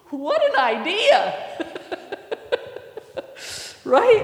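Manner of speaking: loud, high, laughing outburst; laughing exclamation. Real, not acted. Preacher delighting in Peter's bold request, laughing exclamation.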